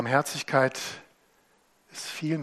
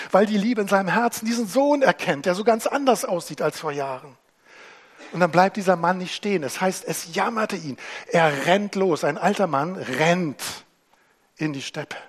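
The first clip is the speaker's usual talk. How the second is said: raised, louder preaching voice building the story; passionate preaching; 6 semitones higher, 7 dB louder than usual. Acted. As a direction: preach it with growing fervour.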